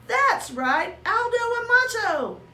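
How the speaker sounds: thrilled little shout; delighted announcement. Acted. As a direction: announce the name with glee. Hamster thrilled by uncle.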